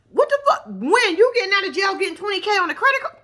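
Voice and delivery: high-pitched retold disbelief; incredulous outburst. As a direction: react astounded to a huge sum. Incredulous at twenty K on card.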